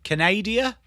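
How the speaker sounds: "Canada" said as kuh-NAY-dee-uh, rising; "Canada" with stretched NAY, rising tune; Canadian way to say Canada. Shown as a demonstration. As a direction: try a Canadian twist on "Canada". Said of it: "Is that how they say it?".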